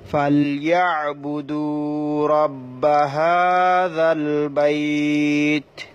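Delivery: slow, drawn-out melodic Quran chant; slow chanted Quranic Arabic for a tajweed class; Quran recitation. Shown as a demonstration. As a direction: recite it slowly with tajweed and stop on the last word. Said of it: "এখানে দেখুন".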